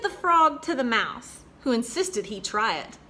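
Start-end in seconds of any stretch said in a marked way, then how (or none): none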